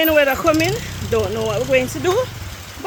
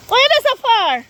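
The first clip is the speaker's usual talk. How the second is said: calling out; 10 semitones higher, 5 dB louder than usual. Real, not acted. Calling to distant walkers.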